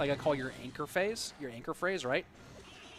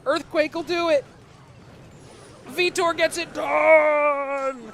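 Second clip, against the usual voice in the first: excited shouted casting, much higher and louder; live play-by-play; 11 semitones higher, 10 dB louder, slower than usual. Acted. Caster calling a close match for viewers, loud and excited. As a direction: call the match like a thrilled caster.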